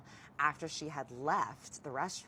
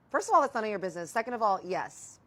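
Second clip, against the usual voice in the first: fast, loud, higher-pitched comeback; indignant retort; 7 semitones higher, 10 dB louder than usual. Retold or imitated. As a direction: fire back at a nosy ex.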